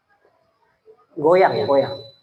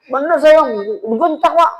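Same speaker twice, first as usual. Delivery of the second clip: mock scolding; 10 semitones higher, 5 dB louder than usual. Retelling the scolding.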